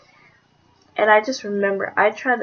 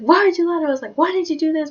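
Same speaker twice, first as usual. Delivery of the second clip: imitating a mad dad, higher and pushing; hammed-up angry interrogation, pitched up; 7 semitones higher, faster than usual. Retold dad demanding why she lied, angry and high. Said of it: "Shit went down".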